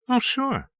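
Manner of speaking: high, falling 'of course'; casual, unbothered high-pitched assent; easy, breezy. A narrator's reading. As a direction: toss it off easily in a high pitch. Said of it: "Duncan said easily".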